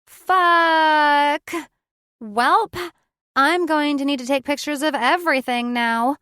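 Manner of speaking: loud, high burst of surprise; resounding exclamation. A narrator's reading. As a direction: blurt it out big. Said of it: "cuts the tension with a resounding".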